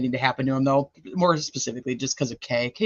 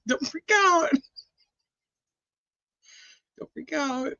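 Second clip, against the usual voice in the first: mock soothing; 10 semitones higher, slower than usual. Soothing viewers about the toe.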